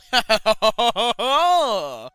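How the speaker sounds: loud high hammed-up villain laugh; over-the-top maniacal laugh; evil cackle. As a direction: cackle like a cartoon killer. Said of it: "I couldn't read that without laughing like that".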